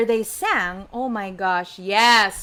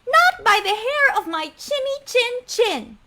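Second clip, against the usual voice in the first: high, chanted piggy refusal; little pig; 9 semitones higher than usual. Acted. Pig replies to the wolf's demand, high chant. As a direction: squeak up high like a cartoon pig. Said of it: "what did the pig say".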